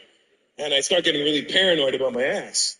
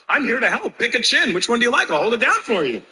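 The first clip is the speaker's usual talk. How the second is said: eager helpful; 8 semitones higher than usual. Retold or imitated.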